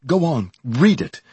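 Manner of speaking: high, loud urging; excited cry. A narrator's reading. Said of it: "he cried excitedly".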